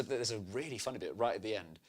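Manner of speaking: normal talking voice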